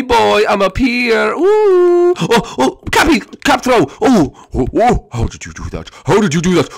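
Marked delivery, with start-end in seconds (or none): 5.1-5.9 very low, soft question; deep monster voice. Acted. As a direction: ask it as the giant. Low voiced take of repeated line.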